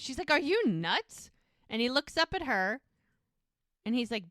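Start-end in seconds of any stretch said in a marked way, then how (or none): 0.3-1.3 higher, slow, drawn-out disbelief; incredulous. Retold or imitated. Bree looks at Mike like he's lost his mind, incredulous. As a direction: stretch it out as a disbelieving question. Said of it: "like he's lost his mind".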